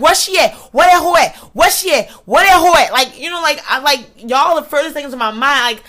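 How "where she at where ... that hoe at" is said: high, urgent repeated questions; acted-out angry searching; agitated demand. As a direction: hunt someone down, punching every other question louder. Acting out hunting for her enemy.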